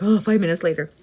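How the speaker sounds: loud, groaning mock dismay; mock exasperation. Worker watching the clock crawl at work, mock exasperated. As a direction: groan it out loudly, as if time crawls.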